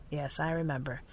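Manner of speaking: low, soft, sad reply; subdued, sorrowful murmur. A narrator's reading. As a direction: say it sadly, voice dropped and quiet. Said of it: "said Jeff sadly".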